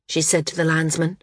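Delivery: ordinary delivery, nothing marked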